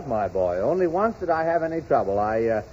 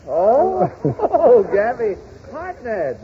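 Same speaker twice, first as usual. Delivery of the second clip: mock sudden recognition; 6 semitones higher, 4 dB louder, much slower than usual. Frank suddenly recognizes the name.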